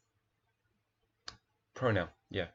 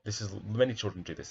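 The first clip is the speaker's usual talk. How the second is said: usual speaking voice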